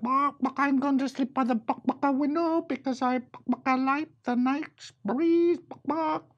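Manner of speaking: very high chicken voice with bark clucks; clucking chicken. A narrator's reading. Chicken choosing the window, in a very high clucking voice. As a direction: squawk it like a hen. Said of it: "The chicken said".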